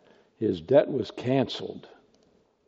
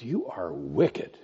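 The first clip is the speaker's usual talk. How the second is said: slow stern condemnation; 6 semitones lower, 7 dB quieter, slower than usual. Acted. Master condemns servant.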